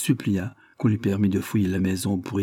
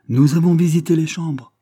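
interrompre d'une voix haute et forte; interruption sèche; 7 semitones higher, 7 dB louder than usual. A narrator's reading. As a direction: couper la parole, plus fort et plus haut. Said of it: "interrompit le coroner". (interruption vive du coroner)

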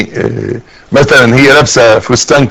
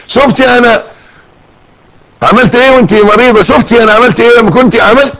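reproachful reminding; 6 semitones higher than usual. Acted. Husband reminding wife of favors.

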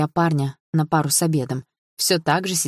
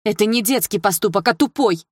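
louder, higher, hitting детский and тупой hard; angry exclamation; 6 semitones higher, 4 dB louder than usual. A narrator's reading. Dilara correcting Mel, angrily shouting. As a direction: throw тупой back at him. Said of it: "воскликнула Дилара".

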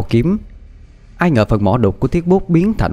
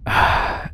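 soft, breath-only sigh; weary exhale; long sigh; 5 dB quieter than usual. Acted. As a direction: let out a resigned sigh. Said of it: "Triệu Côn lắc đầu thở dài".

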